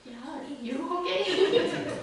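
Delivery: slow, loud, half laughing, half crying; blubbering question through laughter; laughing through tears. Retold or imitated. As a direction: retell it with a choked laugh. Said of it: "Crying, bawling".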